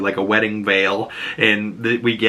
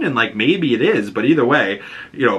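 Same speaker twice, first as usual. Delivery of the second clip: ordinary talk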